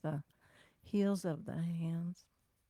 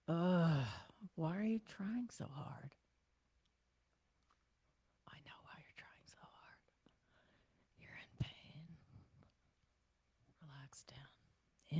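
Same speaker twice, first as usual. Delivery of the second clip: unvoiced soothing whisper. Soothing her own tense jaw.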